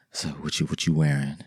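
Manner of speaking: lightly voiced low seductive man; smooth phone seducer. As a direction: drop it low to flirt on the phone. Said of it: "hit her with one of those".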